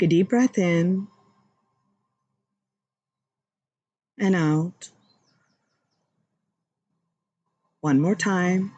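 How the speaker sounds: guided meditation. Acted. Host guiding viewers through breaths, calm meditation voice.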